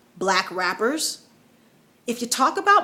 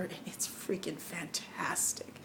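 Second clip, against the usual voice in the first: slow, whispered emphasis; awed whisper, drawn out; hushed rave; 4 semitones lower, 15 dB quieter, slower than usual. Real, not acted. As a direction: drop to a whisper and savor each word. Hushed emphatic praise of album.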